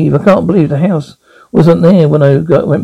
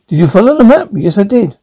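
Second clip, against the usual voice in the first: ordinary talk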